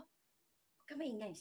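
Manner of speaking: slow hushed shh to keep someone quiet; hushed warning. Retold or imitated. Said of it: "sabi ko".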